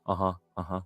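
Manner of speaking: soft, tuned-out uh-huhs; distracted acknowledgment. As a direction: imitate someone half-listening, voice down. Distracted gamer ignoring his wife.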